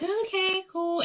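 high, soft, spaced-out okay; mock stoned okay. Retelling her spaced-out reply, high and soft. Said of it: "So I'm like".

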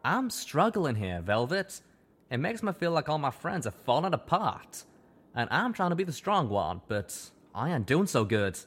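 lightly voiced cowboy stallion, troubled; a hint of a struggling country stallion; Calamity's voice. A narrator's reading.

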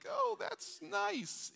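very high, slow, sing-song parent praise; fake polite praise. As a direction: gush over a scribble you can't read. Parent praising a child's drawing.

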